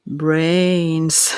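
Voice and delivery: lightly voiced zombie, drawn out; a hint of zombie moan; zombie mantra. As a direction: draw the word out like a zombie chant. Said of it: "does credit to the zombie mantra".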